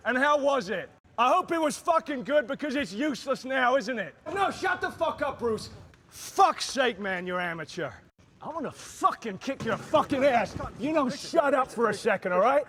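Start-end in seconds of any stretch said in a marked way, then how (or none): none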